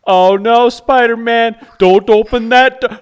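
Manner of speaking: high, loud, yelling at the screen; excited moviegoer yelling. Retold or imitated. Theater fan warning Spider-Man, high loud yell. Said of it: "he's straight up going like".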